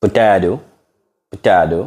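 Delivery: American Potato with the T as a D; demonstrating American pronunciation; American accent. Shown as a demonstration. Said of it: "American accent".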